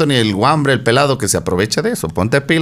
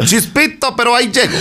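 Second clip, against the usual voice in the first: high laughing mock boast; 5 semitones higher than usual. Tipsy partygoer laughing at his own excuse. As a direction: giggle through it, pitched up.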